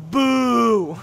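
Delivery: long, high, loud boo; loud jeer. Pretend. Gamer booing the game, loud jeer.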